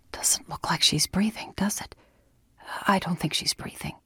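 soft whispered worry over someone sleeping; hushed whisper. Acted. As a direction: whisper it, barely voiced. Whispered night exchange over sleeping woman.

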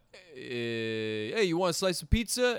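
lightly put-on Italian New York accent offering pizza; a hint of a Brooklyn Italian pizza guy; Italian New Yorker. Italian New Yorker offering Jason pizza, light Italian New York accent. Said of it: "some Italian guy going".

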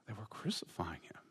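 barely voiced, reverent; whispered. Preacher whispers about those crucifying Jesus. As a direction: fade the words to a hushed whisper.

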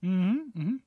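talking around a thermometer; mumbling with a thermometer in mouth. Real, not acted. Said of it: "I have a thermometer in my mouth".

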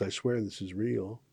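ordinary delivery

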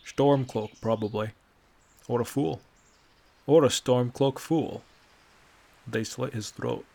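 put-on loud, blunt old man voice; gruff old Nord. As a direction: bark it like a weary old Nord. Bracknell's lines in the story.

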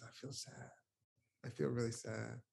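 near-whispered, sinking very soft; imitating a sad woman barely able to say it; a breath of an admission, mildly imitated; hushed confession. Woman admits sadness, retold.

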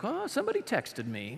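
high, soft put-on voice; joking mock reply. God jokingly explaining how he knew, mock-innocent.

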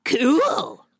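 "Cool" said as a long kyool; drawn-out "kewl". Acted. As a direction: stretch "Cool" into an affected kyool. Performing the spelled-out K E W L.

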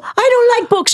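put-on high, loud childish outburst; angry child. A child refusing the librarian, high and angry. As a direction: snap it like a furious kid. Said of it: "I was so angry".

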